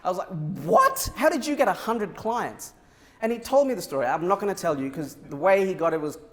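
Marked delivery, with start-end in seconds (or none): 0.6-2.7 a sudden high "What?" in disbelief; surprised. Retold or imitated. Retelling his shock at the teen's hundred clients, amazed. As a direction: jump up in pitch, amazed.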